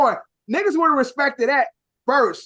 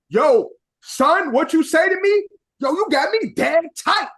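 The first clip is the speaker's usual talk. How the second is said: mock New York accent, pitched up; put-on New York talk; 5 semitones higher, 3 dB louder than usual. Chicagoan showing what he wouldn't say, mock New York accent. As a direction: challenge someone in imitation New York slang, higher. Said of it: "who lived in New York".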